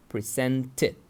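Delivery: "presented" with a distinct final syllable "tid". Shown as a demonstration. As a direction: demonstrate "presented", sounding the -ed as its own syllable. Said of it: "When the verb finishes in d".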